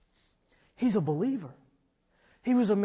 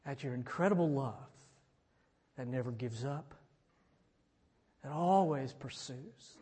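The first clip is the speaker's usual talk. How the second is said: soft, low, unhurried prayer voice; hushed heartfelt pastoral prayer; closing prayer; 4 semitones lower, 9 dB quieter than usual. Real, not acted. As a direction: pray quietly over the congregation.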